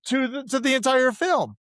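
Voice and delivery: pitched well up in disbelief; indignant rant. Real, not acted. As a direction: protest it, voice riding high. Outraged about the dogs.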